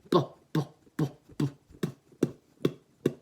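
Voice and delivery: slow bu bu, the vowel fading out; slow kick drill. Shown as a demonstration. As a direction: ease the vowel out beat by beat. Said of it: "I'm kinda turning the dial".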